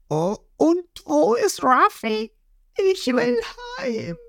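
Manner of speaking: very high, slow, whiny cartoon voice; whimpering crew. Nami and Lysop crying on deck, high mock whimper. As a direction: whine it like a scared kid. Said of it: "sitzen weinend am Boden".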